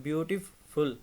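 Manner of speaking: Beautiful slowly, with a gap before फुल; beautiful split in two with a clear break; pause before last syllable. Shown as a demonstration. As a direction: stretch beauty, pause, then add फुल. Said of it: "उसमें आप थोड़ा सा वो pause कर रहे हो".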